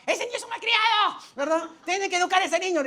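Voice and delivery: shrill-high, loud scolding grandma; high, loud mock grandma yelling. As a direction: shriek like a meddling grandma. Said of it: "porque la abuela gritaba".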